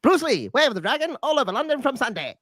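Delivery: high-pitched mock seventies announcer, laughing; old broadcast voice. Imitating the old TV trailer for a friend, a high laughing announcer. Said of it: "this voice comes on and goes".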